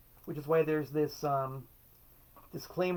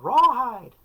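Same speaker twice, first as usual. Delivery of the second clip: a mock crowd request, higher and louder; audience shout; 8 semitones higher, 12 dB louder than usual. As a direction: yell a song title from the crowd. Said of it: "Somebody calls out".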